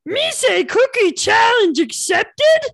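high rough monster voice, loud; Cookie Monster voice. Acted. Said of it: "do a Cookie Monster voice".

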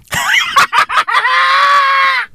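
high, drawn-out cackle; helpless high laughing fit. Real, not acted. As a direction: crack up with a long, high-pitched laugh. Cracking up at own joke.

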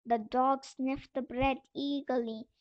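ordinary delivery, nothing marked

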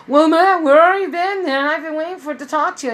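loud, high hail across the hall; neighbor calling out. Retold or imitated. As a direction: call out to Matt like an eager neighbor. Neighbor hails Matt.

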